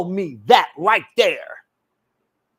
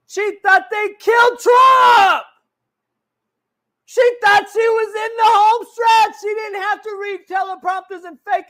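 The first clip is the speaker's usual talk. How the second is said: ranting shout; 12 semitones higher, 7 dB louder than usual. Real, not acted. Ranting about Kamala's campaign.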